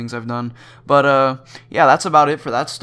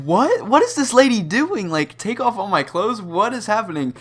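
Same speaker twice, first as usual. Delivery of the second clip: high, incredulous squeak of alarm; pitched way up in stunned panic; shocked disbelief; 9 semitones higher, 3 dB louder than usual. Retold or imitated. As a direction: sound floored by the demand. Stunned at the strip-search order.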